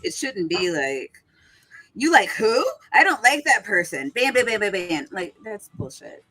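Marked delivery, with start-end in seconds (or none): 1.9-5.1 higher, louder mock indignation; hammed-up outburst with rapid-fire bams; mocking imitation. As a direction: imitate a petty, trigger-happy moderator. Mimicking trigger-happy moderators banning people.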